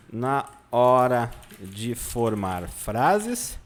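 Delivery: very slow, broken into chunks; dictating while writing. Real, not acted. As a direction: stretch the words to keep pace with your writing.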